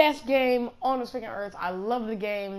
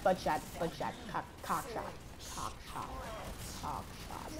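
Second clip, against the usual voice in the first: low, soft trailing repetition; silly muttering; 3 semitones lower, 13 dB quieter than usual. Pretend. Gamer riffing on headshot to himself, muttering that fades out. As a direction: let each repeat sink further away. Silly chant fading away.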